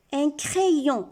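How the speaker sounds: "crayon" said cré-yon, first vowel é; correct reading. Shown as a demonstration. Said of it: "On ne dit pas crayon".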